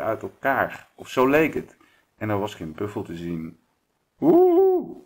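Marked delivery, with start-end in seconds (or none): 4.2-5.0 one high, loud, drawn-out howl; wolf howl. A narrator's reading. Werewolf finding no buffalo among the stones, high howl. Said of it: "huilde de wolf".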